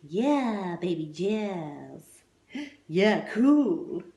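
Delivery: laid-back hipster cheer; cool crowd voice. Acted. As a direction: savour it like a jazz cat. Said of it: "the audience were calling out".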